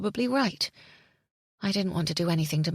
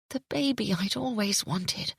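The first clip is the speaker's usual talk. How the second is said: ordinary delivery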